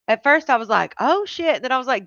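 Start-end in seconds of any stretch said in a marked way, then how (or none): 1.0-1.6 mock startled, pitched up; retold alarm. Streamer retelling her first reaction to the message, mock alarm. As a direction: jump higher for a replayed first reaction.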